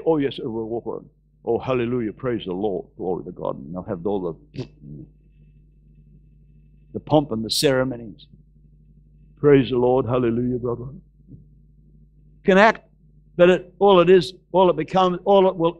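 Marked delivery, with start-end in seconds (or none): 0.0-10.9 low mock-religious voice; pious churchgoer. Preacher mimicking religious pomp and ceremonies, low mock-pious voice. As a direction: put on pompous piety, low and subdued.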